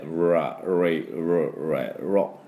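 ラ、レ、ロ as English R syllables, tongue pulled back; 舌を引いたR. Shown as a demonstration. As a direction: curl back into ラ、レ、ロ, r-coloured. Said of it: "下を引いて、". (ラ、レ、ロ を舌を引いたRの音で)

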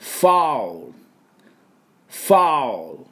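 ファウル clearly for repetition; model foul. Shown as a demonstration. Teacher leading repetition drill, clear model. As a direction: say ファウル for them to repeat. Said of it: "私の後に発音いきましょう".

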